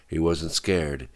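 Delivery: ordinary delivery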